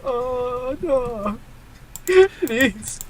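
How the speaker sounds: a beaten waiter pleading; mock whimper. Echoing the crumpled waiter.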